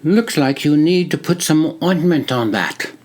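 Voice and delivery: put-on friendly greeting in a British-like accent; a soldier greets a visitor in a British-like accent; crisp British-like accent. A narrator's reading. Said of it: "crisp, British-like accent".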